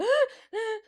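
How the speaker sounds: high squeaky gasping laugh; high squeaky toy gasp. Retold or imitated. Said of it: "he'd be like".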